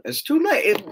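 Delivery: high, whiny protest; squeaky protest. Real, not acted. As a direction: pitch it up into a whine. Repeats refusal, squeaky.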